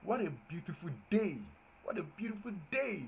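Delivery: joyful exclaim to the class; exclamatory. Shown as a demonstration. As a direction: marvel at the morning. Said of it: "you see that day is exclamatory".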